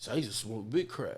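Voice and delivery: normal talking voice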